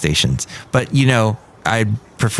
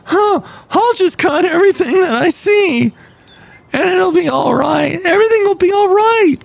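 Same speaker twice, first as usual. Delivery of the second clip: high, loud cartoonish dimwit; goofy cartoon voice; 3 dB louder than usual. Host imitating the Comcast kid, high cartoon voice. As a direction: play the careless kid as a Simpsons character. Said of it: "This is how he sounded".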